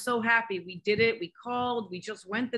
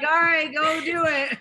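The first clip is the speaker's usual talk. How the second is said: laughing through a quoted go-ahead, pitched up and louder; laughing mom quote; 8 semitones higher, 8 dB louder than usual. Retold or imitated. Mom retells her reply to daughter on a call, laughing loudly. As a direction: mom laughs while replaying what she told her daughter. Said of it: "I'm like".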